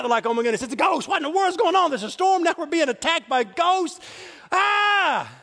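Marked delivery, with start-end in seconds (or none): 0.2-4.0 high, loud mock panic; hammed-up terror, pitched way up; freaking out. Disciples spot a ghost on the lake, freaking out. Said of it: "they start freaking out". 4.5-5.3 loud high mock scream; hammed-up shriek of terror; panicked scream. Disciples in the boat attacked by ghosts, screaming in panic. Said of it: "they start freaking out".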